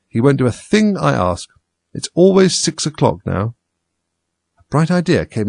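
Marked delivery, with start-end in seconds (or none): 0.1-3.6 higher-pitched, slowing lament; mournful. A narrator's reading. Hatter to Alice about stopped time, mournfully. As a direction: complain dolefully, slowing toward the end. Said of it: "in a mournful tone".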